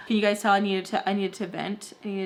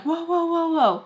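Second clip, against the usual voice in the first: mock intervention; 8 semitones higher than usual. Bystander to brawlers, mock intervention. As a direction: imitate a bystander trying to break it up. Imitates Peter's feeble intervention.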